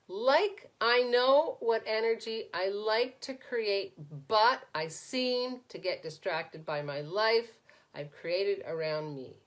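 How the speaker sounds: slowed right down, weighing each word; slow, deliberate reread. Shown as a demonstration. Coach slowly rereads a viewer's comment to the group. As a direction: read it back slowly so they hear themselves. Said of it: "So listen to this".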